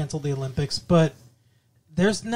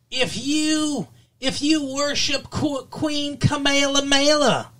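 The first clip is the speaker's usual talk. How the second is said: mock joke setup; 10 semitones higher, slower than usual. Mock Foxworthy-style joke setup.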